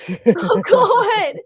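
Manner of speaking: loud, high laughing outburst; laughing exclamation. Real, not acted. Friend reacting to a Scottish Alexa impression, laughing loudly.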